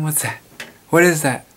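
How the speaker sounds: usual speaking voice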